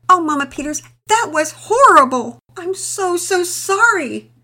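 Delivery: high, loud distressed cry; exclaiming. A narrator's reading. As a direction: burst out in dismay. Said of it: "Penelope exclaimed".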